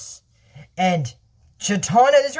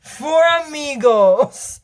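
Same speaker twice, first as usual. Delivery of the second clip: high, stretched-out proclamation; grand name announcement; 7 semitones higher, 4 dB louder, much slower than usual. Acted. Shade pitches a team name, high and drawn out. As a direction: draw the name out big and high.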